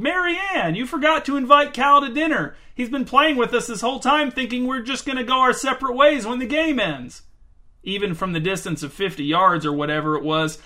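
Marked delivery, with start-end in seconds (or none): 0.0-7.2 higher voice, calling far; calling across the field. A narrator's reading. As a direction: call out to someone fifty yards away. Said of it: "shouted to the other end of the field".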